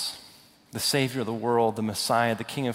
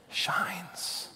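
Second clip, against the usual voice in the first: whispered shines, much softer; 4 semitones higher, 9 dB quieter than usual. Acted. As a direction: breathe the word shines. Dramatic scripture reading.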